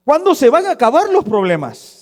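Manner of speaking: high, loud exasperated outburst; fed up. Retold or imitated. Church member complains to pastor about problems, exasperated. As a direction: burst out as someone at their limit. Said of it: "estoy harto de tantos problemas".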